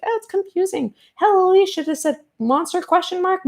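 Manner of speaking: lightly mocking high voice; mocking the crowd. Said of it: "And everybody was like".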